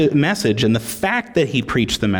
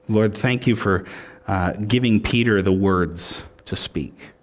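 closing prayer. Real, not acted.

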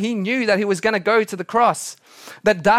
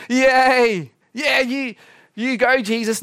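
mock cheering; 6 semitones higher, slower than usual. Mock crowd cheering that never happened.

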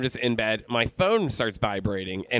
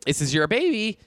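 retold screaming; 9 semitones higher than usual. Pregnant woman confronting Chuck, retold screaming. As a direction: imitate her screaming, held back in volume. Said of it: "she was screaming".

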